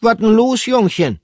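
high, loud cheeky mouse voice; perky little creature asking; high character voice. Acted. Pomme de Terre asks the sad hamster, high and loud.

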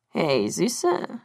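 low, purring thug with unfriendly intent; deep, oily, threatening come-on; menacing purr. A narrator's reading. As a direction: purr it low, like a creepy catcall. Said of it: "schnurrte einer der Männer mit einer Stimme".

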